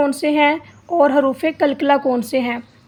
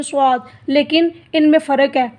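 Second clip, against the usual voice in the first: usual speaking voice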